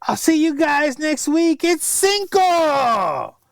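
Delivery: high, drawn-out party-guy cheer; hammed-up gringo excitement; excited Anglo guy. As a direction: mimic the overdressed Anglo gushing about Cinco. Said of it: "and he says".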